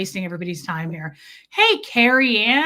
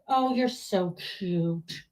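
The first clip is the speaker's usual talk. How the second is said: cooing; 3 semitones lower, 5 dB quieter, slower than usual. Real, not acted. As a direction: coo softly at him. Cooing at the cat.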